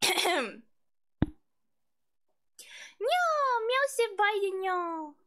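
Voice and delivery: high, mewing catboy put-on. Streamer performing a redeemed sentence, high catboy voice. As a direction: play a cutesy cat-boy, pitched right up. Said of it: "that's my Catboy voice".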